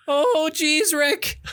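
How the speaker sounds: put-on high, nervous stammering teen voice; Morty. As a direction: whine it like a jittery teen. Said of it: "Morty, Morty".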